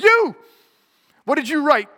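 high, loud barked question; shouted demand. Retold or imitated. Teacher pointing at a frightened student, loud shout. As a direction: jab the word at a student.

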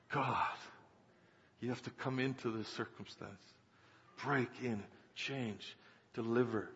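soft prayer with long pauses; hushed pleading prayer. Retold or imitated. Preacher retelling his prayer for the family, hushed plea. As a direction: plead quietly, phrase by phrase.

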